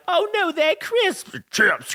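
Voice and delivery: hammed-up posh Brit insisting; British accent. Friend mimicking the British team, high and slow. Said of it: "they're like".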